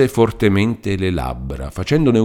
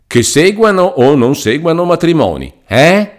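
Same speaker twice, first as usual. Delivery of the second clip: pitched higher and louder on the key clause; emphatic reading; 10 semitones higher, 8 dB louder than usual. Acted. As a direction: stress the key clause to point it out. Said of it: "con grande espressione". (lettura enfatica, più acuta e più forte)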